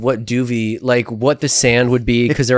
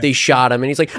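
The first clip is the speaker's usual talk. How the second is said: ordinary talk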